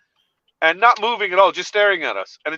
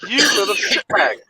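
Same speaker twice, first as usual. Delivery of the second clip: laughing; 4 semitones higher than usual. Retold or imitated. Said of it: "I'm like".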